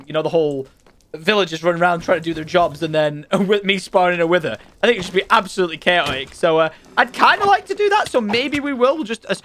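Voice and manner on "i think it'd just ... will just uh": ordinary talk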